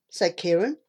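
ordinary delivery

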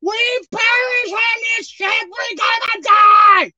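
high, panicked shout of the drowning disciples. Retold or imitated. Frightened disciples crying to the Lord, high panicked shout. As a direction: cry out like men sure the ship is sinking. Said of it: "saying, 'Lord".

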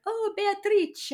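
high, slow, drawn-out Italian greeting; vendor greeting her. Retold or imitated. Mario greeting Beatrice by name, high and slow. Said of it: "how he's like".

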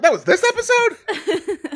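high, slow, loud squeal of disbelief; shocked disbelief voice. Shown as a demonstration. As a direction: drag it out high, stunned. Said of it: "do 'em really quick".

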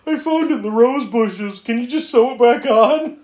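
high-pitched imitated plea; pleading film character.

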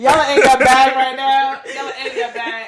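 laughing loudly, breathless. Real, not acted. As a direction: crack up laughing. Cracking up laughing.

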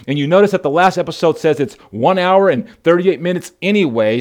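ordinary talk

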